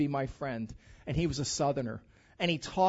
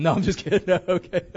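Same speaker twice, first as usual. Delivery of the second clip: laughing joke; 8 dB louder, faster than usual. Real, not acted. Joke after fake confession.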